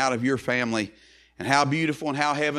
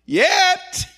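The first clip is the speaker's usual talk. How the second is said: high, loud pulpit outburst; ringing proclamation leaping far up in pitch; preacher's cry; 7 dB louder than usual. Real, not acted. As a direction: cry it out as a triumphant turning point. Preacher cries key word of verse.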